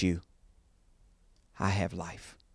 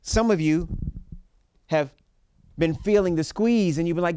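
preaching; 4 semitones higher, 4 dB louder than usual. Acted. Preaching at full force.

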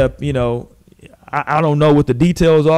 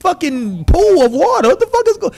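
incredulous outburst; 10 semitones higher than usual. Retold or imitated. Host yelling at [name] in disbelief, high-pitched. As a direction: yell at him in disbelief, voice way up. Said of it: "I'm like".

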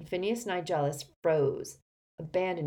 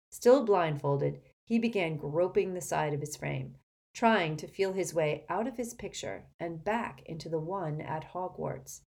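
ordinary delivery, nothing marked

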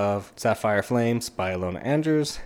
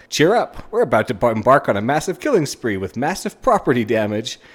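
high, loud cheerful menace; rally them with cheery bloodlust; gleeful cheer; 3 semitones higher, 5 dB louder than usual. Retold or imitated. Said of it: "he's like".

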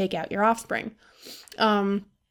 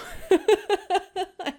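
laugh; 9 semitones higher than usual. Real, not acted. Laugh after quote.

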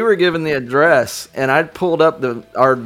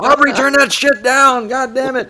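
high, loud scolding yell of a father; scolding by full name; 11 semitones higher, 4 dB louder than usual. Retold or imitated. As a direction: bellow the daughter's name in anger. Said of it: "her dad screamed her name".

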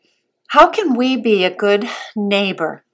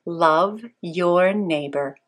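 slow memory verse; 4 semitones lower, much slower than usual. Shown as a demonstration. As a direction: say each word slowly for children. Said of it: "Let's say our memory treasure one more time".

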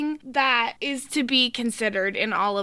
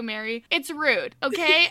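ordinary delivery

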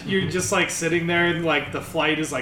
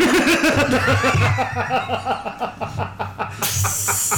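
laugh; 6 semitones higher, 3 dB louder than usual. Real, not acted. Laughs at the remark.

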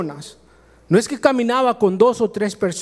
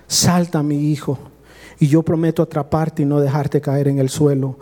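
father's reassurance; 7 semitones lower than usual. Retold or imitated. Father calls son to jump.

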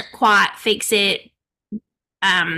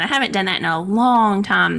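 normal talking voice

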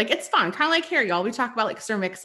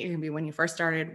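ordinary talk, nothing marked

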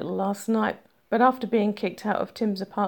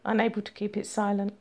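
usual speaking voice

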